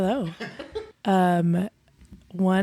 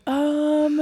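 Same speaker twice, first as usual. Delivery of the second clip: put-on higher, slow, hesitant child's voice; worried kid; 7 semitones higher, slower than usual. Kid telling the teacher about the class guinea pig, imitated hesitant child. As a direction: imitate a child hesitantly breaking bad news. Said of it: "One of the kids came and told me".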